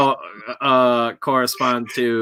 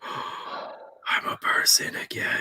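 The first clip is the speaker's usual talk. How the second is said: whispering; 9 dB quieter, much slower than usual. Real, not acted. Gasp on camera coming back.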